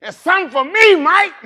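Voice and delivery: high, loud put-on voice pleading with Mike; a pleading brother, voice pushed high; Fredo. Preacher playing Fredo pleading with Mike, high and loud. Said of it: "I know Fredo".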